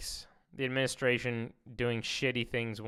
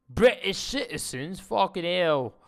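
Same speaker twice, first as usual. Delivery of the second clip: put-on higher, louder British accent, slow; mock British accent; 5 semitones higher, 5 dB louder, slower than usual. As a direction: ham up a British bloke. Mocking British accent aside.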